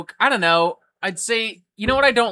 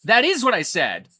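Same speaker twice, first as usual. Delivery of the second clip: higher, louder, emphatic and fed up; retold exasperation; 9 semitones higher, 7 dB louder than usual. Pointing at his own quote to an accuser, loud and exasperated. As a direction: hammer the point home, annoyed. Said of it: "and I'd go".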